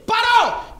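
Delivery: sharp loud halt command; commanding shout. Retold or imitated. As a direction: bark the order at the robbers. Ordering the robbers to stop.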